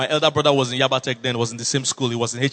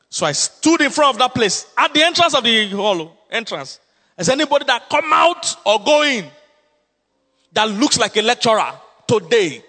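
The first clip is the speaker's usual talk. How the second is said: higher, louder preacher's proclamation; preaching; 7 semitones higher, 5 dB louder than usual. Acted. Pastor retelling his stand at the gate, loud and preaching. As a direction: proclaim it like a vow. Preaching the story at full force.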